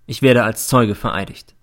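ordinary delivery, nothing marked

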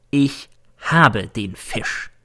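very slow, word by word; momentous announcement. Acted. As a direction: draw each word out for suspense. Julius Cäsar announces his big news. (bedeutungsvoll, sehr langsam)

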